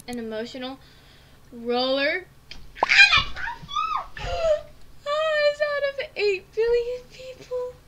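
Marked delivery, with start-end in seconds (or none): 2.3-5.0 a big, high gasp that turns to a shriek; shocked gasp. Real, not acted. Shocked gasp and shriek at the result. 5.0-7.7 high and loud, almost crying; stunned disbelief. Real, not acted. Player stunned by a one-in-billions match, high disbelief.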